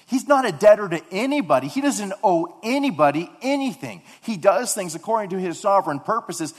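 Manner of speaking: loud, fervent preaching; preaching at full force. Acted. Pastor proclaims God owes nobody, preaching loudly. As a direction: drive the point home to the congregation.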